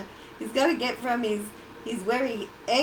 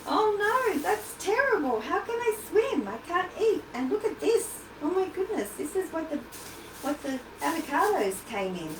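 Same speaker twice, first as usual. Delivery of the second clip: worried crab puppet; 3 semitones higher than usual. Pretend. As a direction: play the crab horrified by the plastic bag. Crab puppet distressed by plastic.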